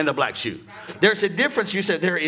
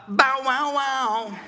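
loud, high mock barking; dog bark imitation; 9 semitones higher, 4 dB louder, much slower than usual. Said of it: "not even a dog will say".

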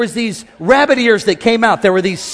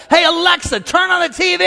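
high, raised yell; yelling at Alexa; 8 semitones higher than usual. Retold or imitated. Ashton yelling at Alexa over the music. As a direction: yell it at the gadget. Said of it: "you're yelling at this thing".

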